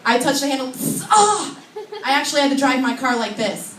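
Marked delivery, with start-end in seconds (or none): 1.1-1.6 high, loud yelp; mock pain yelp. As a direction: yelp as if burned on a handle. Touches hot car handle.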